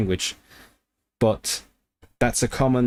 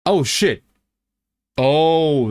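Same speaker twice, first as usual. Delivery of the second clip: higher and louder surprised outburst; excited exclamation; 6 semitones higher, 5 dB louder, much slower than usual. Real, not acted. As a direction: genuine thrill at the discovery. Surprised at real word.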